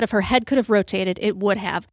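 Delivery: plain reading voice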